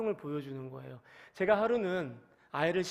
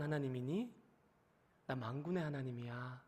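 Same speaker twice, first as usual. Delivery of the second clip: soft, low, searching questions, much softer; a tender God asking David what he wanted; God pleading gently; 5 semitones lower, 5 dB quieter than usual. Acted. God asking David about the new cart, hushed and tender.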